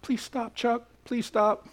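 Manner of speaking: weary plea. Retold or imitated. Preacher recalling begging navy friend Chuck to stop singing, soft plea. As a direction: beg him quietly to give it up.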